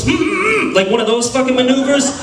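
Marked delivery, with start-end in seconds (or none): 0.0-0.7 mock scared whimper. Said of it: "one of those internal fears".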